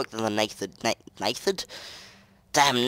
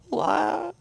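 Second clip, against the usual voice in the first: much higher, drawn-up wow; mock amazement. Real, not acted. Gamer reacting to a strange item, mock amazed wow. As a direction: gush at the shiny item.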